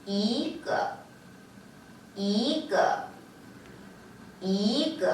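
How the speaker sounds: 이 lifted upward before a short 끄; rising second tone. Shown as a demonstration. Teacher drilling one piece for students, 이 rising into 끄. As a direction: glide 이 up, cut 끄 short. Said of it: "앞에 이가 위로 들어주게 돼요".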